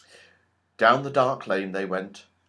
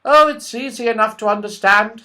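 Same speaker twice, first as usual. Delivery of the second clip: much higher, quick little squirrel voice; tiny squirrel voice; 10 semitones higher, 8 dB louder than usual. Acted. The red squirrel warns Joe the goblins took everyone, tiny and quick. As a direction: chatter like a tiny worried squirrel.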